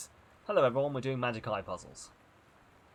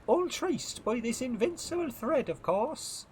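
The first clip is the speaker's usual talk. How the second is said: put-on very high-pitched accented first mate; girl's character voice; 13 semitones higher, slower than usual. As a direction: pitch it way up for the girl. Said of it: "oh, I'm a girl".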